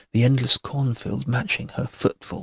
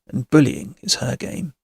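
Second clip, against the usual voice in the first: ordinary delivery, nothing marked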